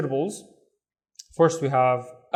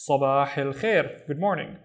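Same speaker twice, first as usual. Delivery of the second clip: ordinary delivery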